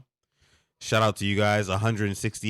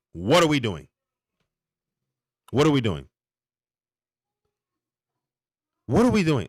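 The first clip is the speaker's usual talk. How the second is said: exasperated; 6 semitones higher, 5 dB louder than usual. Real, not acted. Exasperated rant about touchdown rules.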